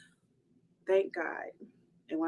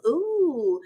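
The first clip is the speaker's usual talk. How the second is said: teasing friend; 7 semitones higher, 5 dB louder than usual. Retold or imitated. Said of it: "kinda, like, egging him on".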